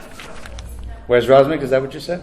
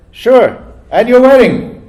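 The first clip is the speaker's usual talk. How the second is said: higher, slow, sly punchline as the rabbi; rabbi's sly comeback; 9 semitones higher, 5 dB louder, slower than usual. Acted. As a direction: deliver the rabbi's comeback slowly, with a knowing twist. Rabbi's deadpan punchline in joke.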